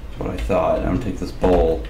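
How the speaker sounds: usual speaking voice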